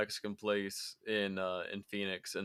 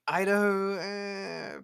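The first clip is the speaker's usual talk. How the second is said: high, dismissive shrug of a voice; unimpressed; 9 semitones higher, much slower than usual. Retold or imitated. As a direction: brush it off, unconvinced. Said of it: "my lady's like".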